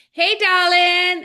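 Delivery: slow, high sultry twang, put on thick; mock country drawl. Mocking the show's country wife greeting her husband. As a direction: purr it like a cowgirl in tight jeans.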